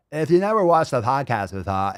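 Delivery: ordinary talk, nothing marked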